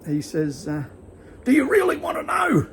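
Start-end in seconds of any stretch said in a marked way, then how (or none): 1.4-2.7 much higher, louder mock yell of the angry stranger; retelling the stranger's shouted threat, high and loud; retold aggressive shout. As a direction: bark the challenge back like the ranting bloke. Angry stranger retold at bus stop.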